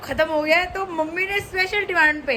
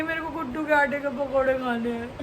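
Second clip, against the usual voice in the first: softer, whimpering little plea; mock-whine like mom craving pakoras; whiny mom imitation; 7 dB quieter than usual. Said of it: "और कह रही है".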